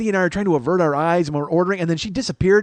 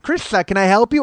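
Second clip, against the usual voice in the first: higher, louder mocked speech impediment; Krista; 6 semitones higher, 7 dB louder than usual. Creepy waitress greeting diners, impeded speech. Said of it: "the speech impediment too".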